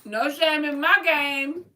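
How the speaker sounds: slow, loud, high boast; playful swagger. Real, not acted. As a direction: own it with cheeky pride. Playful boast after hiccups.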